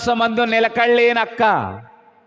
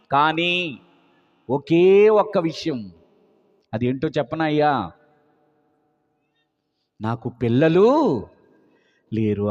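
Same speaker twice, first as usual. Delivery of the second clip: 1 sorrowful confession; 9 semitones lower than usual. Acted. Abraham confesses he has no children.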